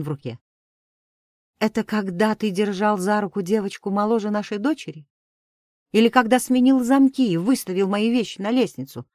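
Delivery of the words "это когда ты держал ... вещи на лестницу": rising angry reproach, getting louder; angry accusation. Acted. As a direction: hurl it back at him bitterly. Wife confronts cheating husband.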